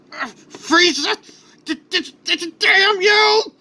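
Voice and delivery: high, strained, stuttering fury; enraged scream. Acted. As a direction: stammer with rage, building to a long scream. Hero snapping with rage.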